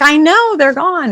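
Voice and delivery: buyer voiced high and bright with news; excited confirmation. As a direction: tell her the cases are gone, pitched high. Buyer voiced in retold call.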